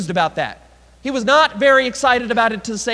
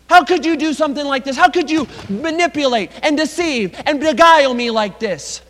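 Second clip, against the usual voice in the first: outraged confrontation; 5 semitones higher, 4 dB louder than usual. Acted. Jacob flies off the handle.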